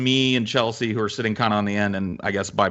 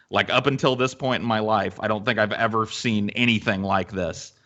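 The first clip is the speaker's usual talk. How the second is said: usual speaking voice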